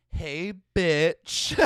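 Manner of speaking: put-on laughing through a cocky cool-girl greeting; cool Supergirl. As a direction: toss it off like a teen hero. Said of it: "seventeen-year-old cool Supergirl".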